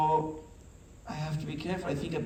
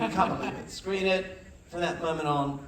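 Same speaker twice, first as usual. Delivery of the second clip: ordinary talk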